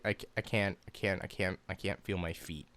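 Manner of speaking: retold pain. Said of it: "I'm like".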